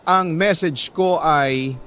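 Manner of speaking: normal talking voice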